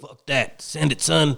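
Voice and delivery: pushy dad. Retold or imitated. Cohost imitating the pushy dad on the dirt bike, mock gruff. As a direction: egg the kid on like a macho dad.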